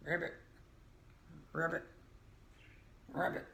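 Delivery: put-on a playful frog ribbit for the kids; frog croak. Green frog is guessed next.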